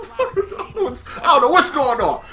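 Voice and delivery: high, slowed words through laughter; laughing. Real, not acted. Storyteller cracking up at his own panic, real laughing. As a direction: crack up while getting the line out.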